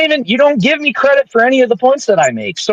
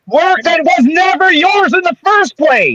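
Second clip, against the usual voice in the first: raised, heated voice; shouting; 6 semitones higher than usual. Real, not acted. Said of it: "Why are you shouting?".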